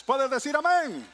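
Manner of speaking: loud, high call to the crowd; calling for amen. Real, not acted. Preacher asking the congregation for an amen. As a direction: rouse the room.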